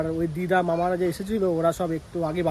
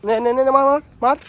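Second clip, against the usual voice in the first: cheering on; 7 semitones higher, 6 dB louder than usual. Real, not acted. Urging the kid to kick.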